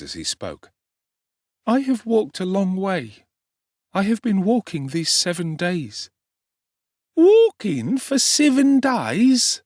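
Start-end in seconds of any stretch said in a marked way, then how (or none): 1.7-6.1 put-on higher young boy's voice, near tears; tearful orphan boy. A narrator's reading. Starving orphan answering the street boy, tearful. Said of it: "replied Oliver". 7.1-9.6 much higher and louder, slow drawn-out disbelief; cocky street boy amazed. A narrator's reading. Street boy marvelling at Oliver's long walk, slow and loud. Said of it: "said the young gentleman".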